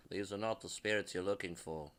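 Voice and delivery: a hint of a low, soft Jedi mind trick voice; Star Wars quote. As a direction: lightly intone it like Obi-Wan waving his hand. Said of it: "another Star Wars reference".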